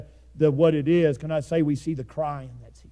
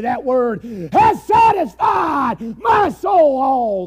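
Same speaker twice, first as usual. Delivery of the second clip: loud, raised preaching voice; fiery preaching; 7 semitones higher, 9 dB louder, slower than usual. Acted. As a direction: press the point hard on the congregation. Preaching at full force, shouting.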